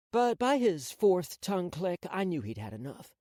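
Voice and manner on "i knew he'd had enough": ordinary delivery, nothing marked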